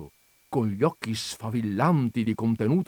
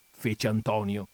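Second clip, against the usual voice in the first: ordinary delivery, nothing marked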